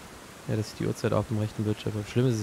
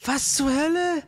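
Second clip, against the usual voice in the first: disbelief; 13 semitones higher, slower than usual. Real, not acted. Baffled by enemy attack.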